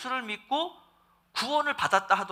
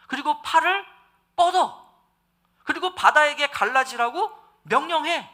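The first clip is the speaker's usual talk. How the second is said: commanding God building up; 6 semitones higher, 5 dB louder than usual. Retold or imitated. God commanding Moses to raise his staff, building to a loud shout. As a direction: voice of God urging Moses, rising higher and louder to a shout. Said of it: "라고 말씀하시는 거예요".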